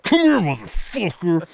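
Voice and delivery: playful fake aggression; mock threat. Calling a fan over, hammed-up menace.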